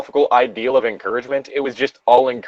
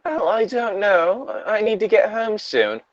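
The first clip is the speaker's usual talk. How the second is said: waver gently in a raised voice; hesitant girl; 10 semitones higher, 4 dB quieter than usual. Acted. Voices the hesitant young blonde.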